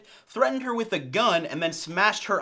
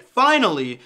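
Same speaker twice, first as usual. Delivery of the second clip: excited finally; 8 dB louder than usual. Acted. Triumphant cry over news.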